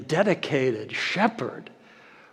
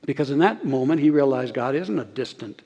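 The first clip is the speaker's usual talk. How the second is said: ordinary talk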